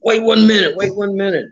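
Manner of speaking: imitating an excited man, pitched well up; Charlie Lay, excited. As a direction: stammer urgently, as if stopping someone mid-step. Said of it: "and he's excited".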